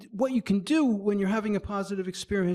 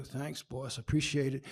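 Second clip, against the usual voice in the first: polite thanks; 3 semitones lower, 6 dB quieter than usual. Pretend. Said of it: "you get a job raise and you can go".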